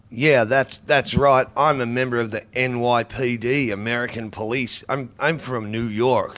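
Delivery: hammed-up fake New York cop accent; bad American accent. Shown as a demonstration. Guest mocking bad Australian actors playing Americans, in a bad American accent. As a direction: badly fake an American accent as an NYPD officer. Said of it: "it ends up being like someone doing a bad American accent".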